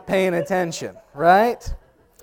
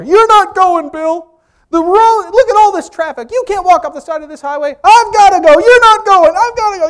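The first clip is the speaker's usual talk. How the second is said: much higher, loud insistent back-and-forth; arguing mother; 12 dB louder than usual. Retold or imitated. Mother to father on the roadside, loud insistent argument. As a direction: refuse to let him walk the highway.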